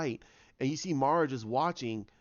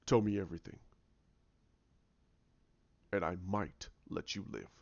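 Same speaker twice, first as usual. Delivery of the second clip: low, soft, measured threat; menacing villain voice; 6 semitones lower, 6 dB quieter than usual. Retold or imitated. Villain offering a captive a chance to live, low and menacing.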